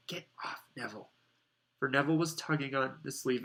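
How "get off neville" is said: unvoiced hushed whisper. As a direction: hiss it quietly. Hushing Neville behind the door.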